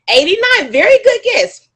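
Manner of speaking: high, loud burst of approval; excited praise. Real, not acted. Presenter praising an audience member's guess, excitedly.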